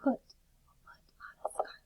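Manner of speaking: soft whispered reading; hushed classroom whisper. Shown as a demonstration. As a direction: whisper it like a secret. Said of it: "Read the sentence in a whisper voice".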